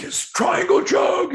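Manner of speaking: put-on very high, slow, loud drunken holler; mock drunk yell. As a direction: drag it out like a clueless fan shouting advice. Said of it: "they're like".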